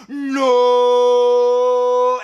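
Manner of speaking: long drawn-out mock-dramatic NO; hammed-up movie slow-motion yell, loud and high; slow-motion shout. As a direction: stretch the shout out on one held note. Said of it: "it's a slow motion thing".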